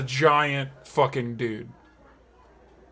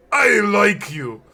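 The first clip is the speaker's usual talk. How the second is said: booming fondness; 6 semitones higher, 6 dB louder, slower than usual. Acted. Tiefling's fond declaration.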